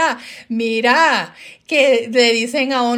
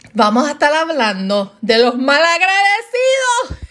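hammed-up rant, building to a high shout; fired up; 4 semitones higher than usual. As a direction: announce it like you're catching fire. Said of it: "la señora Fuego se va a encender".

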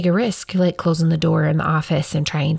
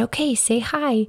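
higher, softer coaxing; coaxing child; 6 semitones higher, 3 dB quieter than usual. Retold or imitated. Mom prompting her son to greet the crowd, softly coaxing. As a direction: gently prompt a toddler. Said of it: "I said".

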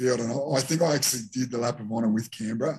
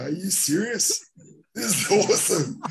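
bright, much higher disbelieving delight, slowing on it; retold excitement; 8 semitones higher than usual. Young player to grieving teammate after the loss, giddy and excited. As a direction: ask it like the best day of your life. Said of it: "jumping out of my skin".

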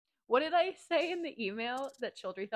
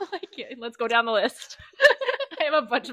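high, loud laughing delivery; 6 semitones higher, 10 dB louder than usual. Real, not acted. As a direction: say it through a burst of laughter. Laughing over embarrassing things.

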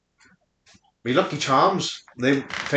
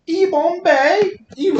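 mock African accent; 12 semitones higher than usual. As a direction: call out in a hammed-up African accent. Starting a new accent bit.

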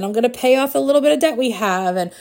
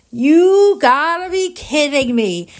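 drawn-out, higher-pitched incredulous exclamation; retold disbelief; 6 semitones higher, 3 dB louder, slower than usual. Telling coach about the lottery numbers, stretched-out disbelief.

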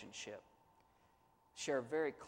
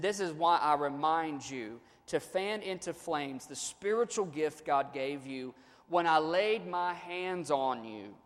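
louder, measured reading of Paul's letter; scripture reading; 5 dB louder than usual. Acted. Pastor reading Paul's letter to his church, lifted reading voice. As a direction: read the verses out to the congregation. Preacher reads scripture aloud.

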